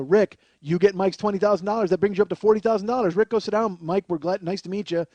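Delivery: loud, upbeat host announcement; game show host. Retold or imitated. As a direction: boom it out like a TV quiz host. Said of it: "Chuck is like".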